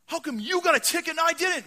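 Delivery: high, loud indignant complaint; upset protest. Acted. Packers fan left without a ticket, loud upset protest. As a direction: protest the unfairness at full pitch. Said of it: "they can get pretty upset".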